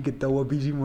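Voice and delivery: put-on Murcian accent. Arguing which accents can be neutralised, imitated Murcian accent. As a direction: imitate a thick Murcian way of talking. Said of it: "A no ser que hable así".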